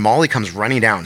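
ordinary talk, nothing marked

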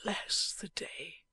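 slow, breathless whisper of a wounded man; dying man's whisper. A narrator's reading. Wounded husband whispering to his wife, faint and slow. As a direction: barely get the words out, fading. Dying Eugene speaks to Lizzie.

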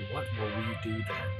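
near silent, hopeless question; hushed despair. Acted. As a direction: let it fade to nothing.

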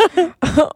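high chuckling chortle. Shown as a demonstration. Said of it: "you know, like".

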